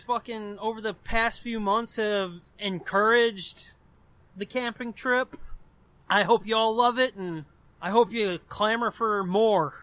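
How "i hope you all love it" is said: usual speaking voice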